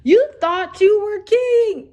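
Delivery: high, loud, slow mocking gloat; hammed-up villain sneer. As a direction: taunt him like a laughing villain. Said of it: "the wicked brother laughed and was like".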